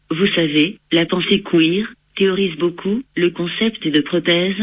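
synthetic computer voice reading text; text to speech voice. Real, not acted. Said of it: "J'utilise un logiciel de synthèse vocale".

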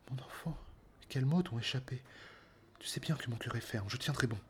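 put-on a faithful old woman soothing her master; a hint of an aged nurse, calmly promising. Old nurse Euryclée answers.

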